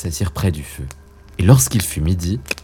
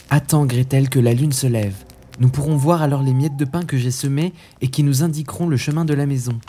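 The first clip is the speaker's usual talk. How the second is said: put-on higher boyish voice, comforting his sister; consoling Hansel; 6 semitones higher than usual. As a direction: soothe her gently, pitched up like a young boy.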